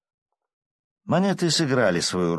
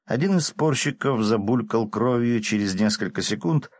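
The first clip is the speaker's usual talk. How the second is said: ordinary delivery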